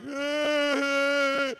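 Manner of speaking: high imitated sob sound; mock crying wail. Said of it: "she's crying like".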